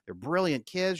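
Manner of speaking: a hint of his wife reporting her day; voicing his wife. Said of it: "And she'll tell me".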